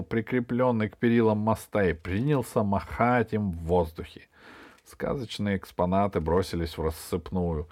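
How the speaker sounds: ordinary delivery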